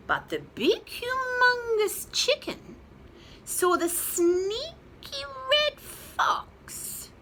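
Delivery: high, slow, big-chick character voice. Acted. Held chick voice in story.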